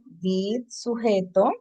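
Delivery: ordinary delivery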